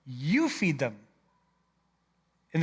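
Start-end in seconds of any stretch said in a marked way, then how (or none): 0.1-1.0 "you" weighted and falling; "you feed them" with a firm, falling "you"; stress on YOU. Shown as a demonstration. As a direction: set "you" back against "we". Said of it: "Not "we feed them," you feed them".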